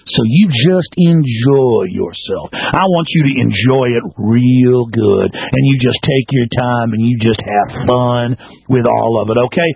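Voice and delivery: low, slow, syrupy menace; sneering mock comfort. Real, not acted. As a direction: purr it like a mocking threat. Mocking, sneering reassurance.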